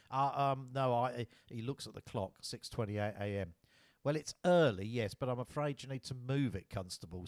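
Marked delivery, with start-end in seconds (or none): 0.1-1.3 flustered stutter. A narrator's reading. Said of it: "as he stutters". 4.0-7.3 put-on English accent. A narrator's reading. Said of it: "in a clipped English accent".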